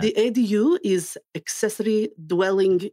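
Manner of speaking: lightly put-on Russian accent, a hint only; mock Russian, played for laughs. Podcast host asks for ADU in Russian accent, light mock Russian. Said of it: "in a Russian accent".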